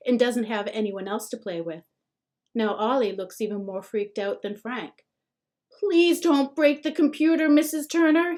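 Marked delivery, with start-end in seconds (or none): 5.8-8.4 trembling plea. A narrator's reading. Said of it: "he says, trembling".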